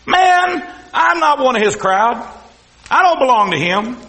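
acted indignant denial, starting high; emphatic denial. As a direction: push the accusation away forcefully. Peter protests the accusation.

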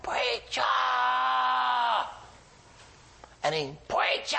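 high called-out Preacher; hollering Preacher. Retold or imitated. Herbie calling the preacher back down the hallway, high. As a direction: call down the hallway from the bed. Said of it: "just kept hollering".

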